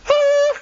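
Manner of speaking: high, loud freak-out yelp; mock panicked scream. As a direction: let out an inner scream of panic, acted out. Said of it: "I'm freaking out right now".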